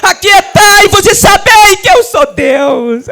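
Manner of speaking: loud, high, very slow proclamation; shouted scripture. Acted. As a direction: thunder the verse over the church. Climax of the sermon. (versículo proclamado aos gritos)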